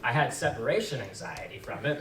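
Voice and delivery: normal talking voice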